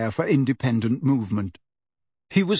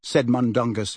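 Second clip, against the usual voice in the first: plain reading voice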